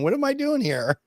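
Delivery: very high, puzzled little kitty voice; confused cat. Pretend. The cat in the refrigerator asking, a very high puzzled kitty voice. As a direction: voice the cat wondering where it is. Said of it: "sitting there going".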